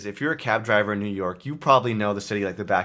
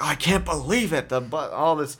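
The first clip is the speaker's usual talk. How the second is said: higher-pitched imitation of a woman panicking; mock freak-out; 5 semitones higher than usual. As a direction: act out her exasperated fretting on the phone. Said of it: "she was freaking out".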